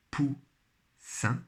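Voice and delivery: "Poussin" split into pou, pause, ssin; syllable by syllable. Shown as a demonstration. Teacher helping pupils find the syllable, syllable by syllable. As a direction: sound out "Poussin" slowly in two beats. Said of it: "Toujours première syllabe".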